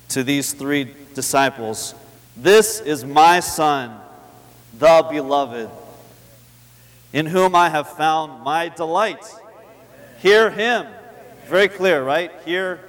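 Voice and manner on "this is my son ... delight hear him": slow, loud, each phrase weighed; emphatic repeat. Shown as a demonstration. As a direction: press every phrase home to the hearers. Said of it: "this was God speaking directly".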